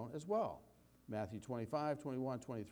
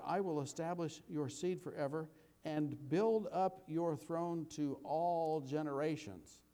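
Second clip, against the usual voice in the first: slow, measured reading of the psalm; scripture reading; 5 semitones higher, slower than usual. Acted. Pastor reading the psalm to his congregation, slow and measured. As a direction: read it out like scripture, unhurried. Reads verse slowly.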